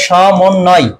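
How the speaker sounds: সমন্বয় slowly as "sho-mon-noy"; slow teaching সমন্বয় opening on sh; syllable-by-syllable pronunciation. Shown as a demonstration. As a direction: draw out each part of সমন্বয়. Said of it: "তালব্য স হবে".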